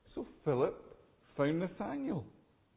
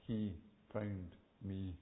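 slow and much softer, lower, almost reverent; quiet humble reply; 8 semitones lower, 10 dB quieter, slower than usual. Retold or imitated. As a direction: say it hushed, like a quiet confession. Wilberforce answers his butler humbly.

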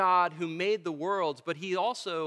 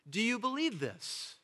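softer, higher, tender asking; gentle question; 6 semitones higher, 4 dB quieter than usual. Acted. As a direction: ask it gently, as Jesus to Martha. Jesus' question, quoted gently.

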